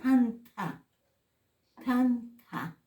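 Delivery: TANTA with a puff of breath after each t; aspirated T. Shown as a demonstration. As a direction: breathe out on both t sounds of TANTA. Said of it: "Aquí con la H".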